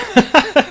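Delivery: high burst of laughter; real laugh. Real, not acted. Amused by the all-caps tweet, bursting out laughing. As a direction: laugh out loud.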